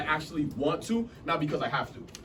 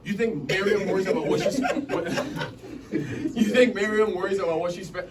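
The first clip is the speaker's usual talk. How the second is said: laughing; 3 semitones higher, 4 dB louder than usual. Real, not acted. Laughs mid-question.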